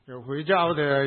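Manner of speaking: normal talking voice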